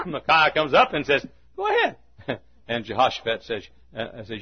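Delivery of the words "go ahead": very high mocking squeal; mock go-ahead. Micaiah comes up to the king, high mocking voice. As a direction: toss it off high in mockery.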